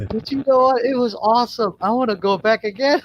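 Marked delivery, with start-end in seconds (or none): none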